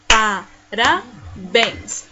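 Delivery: PA, RA, BÉNS with pauses; PARABÉNS slowly, one syllable at a time. Shown as a demonstration. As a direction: split PARABÉNS into three slow beats. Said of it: "E assim separamos aqui as palavrinhas".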